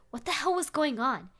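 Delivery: ordinary delivery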